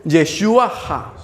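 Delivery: slow, careful "Yeshua Ha" for the church to repeat; teacher saying "Yeshua Ha" slowly. Shown as a demonstration. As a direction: sound out "Yeshua Ha" syllable by syllable. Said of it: "repita conmigo".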